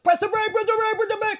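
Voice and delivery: high, loud frantic shouting; panicked yell. Retold or imitated. Retelling yelling at the old man to brake. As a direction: yell it like the car is about to crash. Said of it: "So I'm like".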